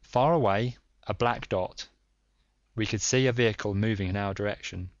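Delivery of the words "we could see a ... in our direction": plain reading voice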